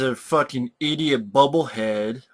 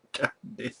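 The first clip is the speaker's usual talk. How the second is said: laughing; 8 semitones higher, 11 dB quieter than usual. Real, not acted. Laughs at the bad acting.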